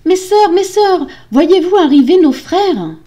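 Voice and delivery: high, loud, desperate call. A narrator's reading. Wife calling her sisters, desperate shout. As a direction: call anxiously to someone far away. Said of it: "elle appela ses sœurs".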